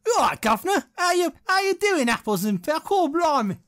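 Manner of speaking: hammed-up London cockney, loud and high; Cockney accent. Friends riffing on English types, mock Cockney chirp.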